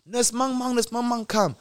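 high, sing-out summons; calling out. Retold or imitated. Hospital staff summoning a nurse, imitated high call. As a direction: call a colleague over in a high voice.

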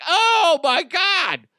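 high, loud shrieking mom voice; hammed-up squealing mother; mock excited mom. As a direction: mom begging to see the gift. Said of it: "And I'm like".

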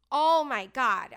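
drawn-out, loud "oh my God"; loud, slow food rapture; gushing. Real, not acted. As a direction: swoon over the memory of dessert. Gushing over the chocolate dessert.

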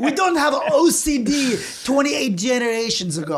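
hammed-up proud tattooist denial; scornful tattoo artist. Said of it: "He goes".

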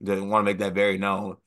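ordinary talk, nothing marked

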